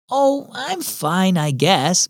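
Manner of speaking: plain reading voice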